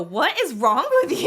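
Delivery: high, loud exasperated shout; mock-yell at a bad driver; mock road-rage yell. What one always goes at drivers, mock exasperated yell. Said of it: "I always go".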